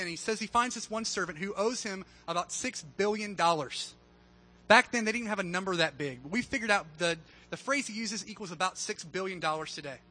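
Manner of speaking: usual speaking voice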